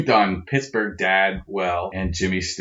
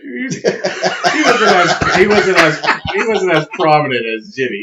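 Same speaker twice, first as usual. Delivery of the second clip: Jimmy Stewart voice; 9 semitones higher, 7 dB louder than usual. Acted. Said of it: "You gotta stutter more".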